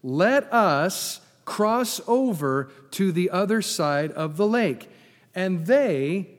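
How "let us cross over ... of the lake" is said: "Let us cross over" slow, each word weighted; emphatic re-reading. Shown as a demonstration. Preacher re-reading Jesus' words to the congregation, "cross over" emphatic. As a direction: spell out "other side of the lake" slowly, firmly. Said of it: "read it again for emphasis".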